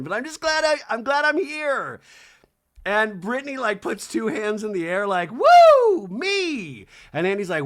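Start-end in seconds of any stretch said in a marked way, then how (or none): 0.0-2.0 very high put-on delight; mock gushing. Newcomer just glad to be on stage, high mock gushing. 5.3-6.9 very loud, high self-celebrating whoop; mock cheer. Newcomer with two hands in the air, loud mock whoop.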